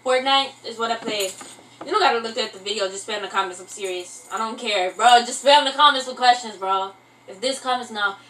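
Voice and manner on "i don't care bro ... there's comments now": normal talking voice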